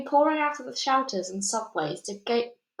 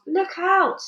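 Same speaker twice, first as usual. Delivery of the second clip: high, loud warning yell; alarmed cry; 9 semitones higher, 6 dB louder than usual. A narrator's reading. Policemen and firemen cry out in alarm. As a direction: warn everyone at once. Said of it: "they cried".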